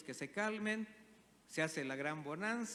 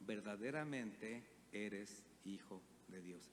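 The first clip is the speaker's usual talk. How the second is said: much softer, slow and lower, in awe; hushed reverence; 7 semitones lower, 7 dB quieter, slower than usual. Acted. Disciples adoring Jesus in the boat, hushed and slow in reverence. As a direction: breathe it out like worship. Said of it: "lo adoraron diciendo".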